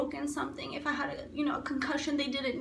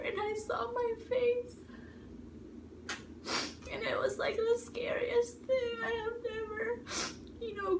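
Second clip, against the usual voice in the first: choking up, higher and thinner; real tearful recall; 8 semitones higher, 4 dB quieter than usual. Real, not acted. Recalling the scariest sight of her face, real tearful voice. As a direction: voice rising high and soft into tears.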